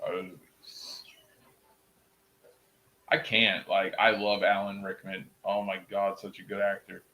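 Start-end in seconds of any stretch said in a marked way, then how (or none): 0.0-1.0 Snape impression. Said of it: "in my best Snape voice".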